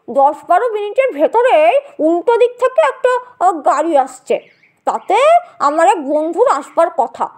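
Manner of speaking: put-on an amused older man recalling a mishap; genial gentleman. (হালকা গলায় রসিক ভদ্রলোক)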